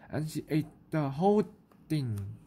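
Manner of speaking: plain reading voice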